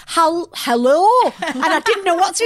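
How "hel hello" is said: higher, louder halting hello; nervous fake hello. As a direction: answer the phone pretending. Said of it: "So I'm going".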